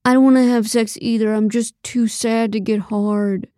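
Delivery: mildly upset, self-pitying character voice; mopey man, freshly cried out; tearful refusal. A narrator's reading. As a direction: protest sadly, quick then slowing down. Tearful Nate voiced by narrator.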